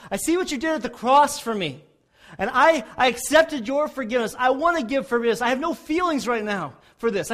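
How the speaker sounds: a firm spoken vow to forgive; bold proclamation. Retold or imitated. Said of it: "you make this proclamation and you say".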